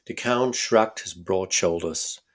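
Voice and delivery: usual speaking voice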